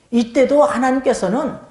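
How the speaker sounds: normal talking voice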